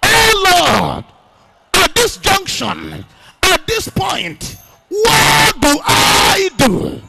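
slow, shouted, drawn-out plea; full-force pleading shout; loud crying prayer. Shown as a demonstration. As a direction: stretch every word in a desperate cry. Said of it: "I'm not hearing you like I want to hear you".

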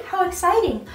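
usual speaking voice

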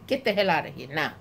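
normal talking voice

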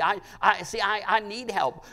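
usual speaking voice

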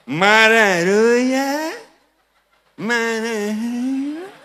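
very slow drawl, rising at the end; scolding mother. Acted. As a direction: imitate a mother's long-stretched scolding. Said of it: "માં છોકરાને મારતી હોય". (માનો ખૂબ લંબાવેલો ઠપકો)

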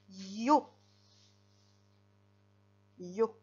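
"Yo" said loud and isolated, then repeated; dictated syllable. Shown as a demonstration. Teacher dictating for the child's slate, "Yo" clearly isolated. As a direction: give "Yo" firmly as one lone syllable.

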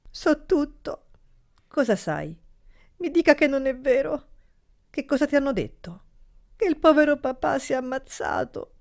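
put-on high, tearful girl's voice; the frightened niece near tears; distressed girl. A narrator's reading. (voce alta di bambina in lacrime)

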